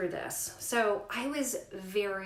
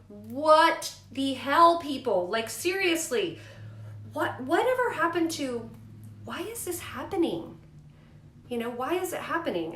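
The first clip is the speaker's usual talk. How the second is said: exasperated outburst; 6 semitones higher, 6 dB louder than usual. Retold or imitated. Mother retelling her anger at the doctors, loud exasperation. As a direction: vent at everyone pushing surgery.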